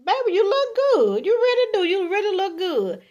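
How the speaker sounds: high, eager admiration; wholehearted compliment. Acted. Man answering how his woman looks, wholehearted compliment. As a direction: gush with emphatic approval. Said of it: "She wanna hear".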